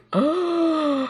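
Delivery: gasp. Real, not acted. Shocked at the gossip.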